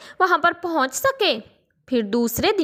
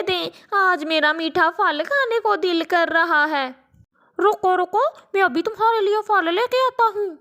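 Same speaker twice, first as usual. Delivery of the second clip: higher, pitying parrot voice; raised caring bird voice; kind parrot uncle; 7 semitones higher than usual. Acted.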